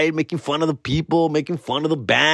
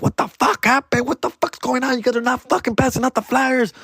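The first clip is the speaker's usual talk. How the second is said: higher, scolding boss voice; angry owner; 9 semitones higher than usual. Retold or imitated. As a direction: chew out the promoters like a furious club owner. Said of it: "She was pissed".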